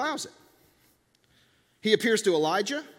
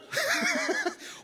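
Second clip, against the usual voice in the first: delighted laugh. Real, not acted. Delighted at the name wonderful.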